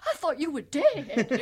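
hammed-up drawn-out imitation; terrible Mark Briscoe. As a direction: do a bad, jokey wrestler impression. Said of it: "That's my terrible Briscoe impersonation".

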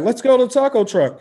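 high, loud echo of chummy cops; mock buddy cop. Echoing cops inviting him out, mock buddy voice. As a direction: call it out like pals off duty.

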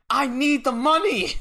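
high, slow, drawn-out wail; desperate mock plea. Suge answers terrified Gene, hammed-up desperate plea. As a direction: plead it high and stretched out. Acted desperate wrestler's reply.

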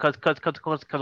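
real stutter on the first word. Real, not acted. Host explaining his next step to his guest, stuttering. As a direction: get stuck repeating the opening word. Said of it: "I don't know why I'm stuttering".

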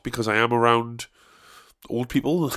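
ordinary delivery

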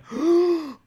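loud, high shocked gasp; mock shocked gasp. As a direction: gasp as if scandalised. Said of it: "And everyone went".

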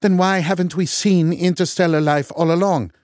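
scornful Norwegian. A narrator's reading. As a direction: sneer it in a Norwegian accent. Loken arguing.

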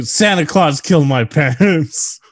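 mock-dramatic movie quote, drawn out; movie villain line. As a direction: ham up the killer's big line. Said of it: "let's go over some of his other good lines".

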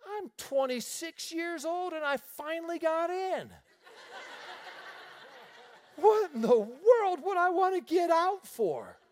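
high incredulous protest; high-pitched indignation; indignant. Retold or imitated. Indignant inner thought.

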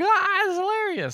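high, drawn-out mock gushing; very high, stretched-out imitation of delight; imitated audience reaction. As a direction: gush like an overimpressed crowd. Imitating the improv class's reaction.

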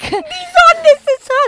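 very high, slow falsetto; a hammed-up squeaky fan voice; mock naive voice. Mocking the naive mom.

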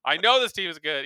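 loud, frustrated boss rant; exasperated GM. Retold or imitated. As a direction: sputter like an annoyed executive. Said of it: "it's such a funny instinct to be like".

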